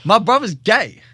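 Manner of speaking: retold news, loud and high; emphatic repeat. Repeats news to unheeding friend.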